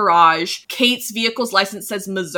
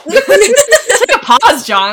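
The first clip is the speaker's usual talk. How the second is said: laughing through the words, much higher; 12 semitones higher, 8 dB louder, much slower than usual. Real, not acted. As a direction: giggle it out. Laughing at own burping.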